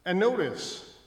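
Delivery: loud, high, hammering preacher's cry; full-force preaching. Acted. Preacher thundering at his church about sexual immorality. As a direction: lift the voice and thunder at the congregation.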